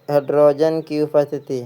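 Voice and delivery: normal talking voice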